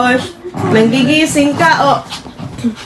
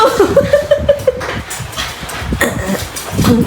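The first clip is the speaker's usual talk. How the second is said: breathless laugh; 8 semitones higher, 4 dB quieter than usual. Real, not acted. Laughing while running.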